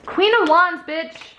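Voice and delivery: high, excited hype; yelling. Real, not acted. Hyping up the viewer in a tarot reading, mock yelling. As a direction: cheer the viewer on, half-yelling. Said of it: "I'm yelling at you".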